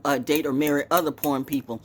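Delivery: usual speaking voice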